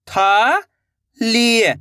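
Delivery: थाली drawn out very slowly, each sound held. Shown as a demonstration. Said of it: "तो मिलके बन जाएगा". (थाली को बहुत धीरे खींचकर बोलें)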